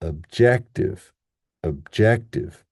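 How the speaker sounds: "objective" slow, with strong JEC; weak schwa "ob", punched JEC in "objective"; stress on second syllable. Shown as a demonstration. Teacher modelling the word, slow "objective" stressed on JEC. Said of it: "power syllable".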